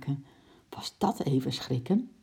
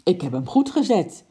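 indignant protest; 3 semitones higher, 6 dB louder than usual. A narrator's reading. Acted quarrel, wife protests.

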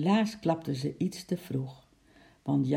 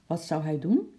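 usual speaking voice

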